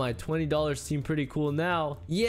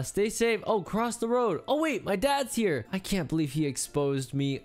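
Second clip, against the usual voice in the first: normal talking voice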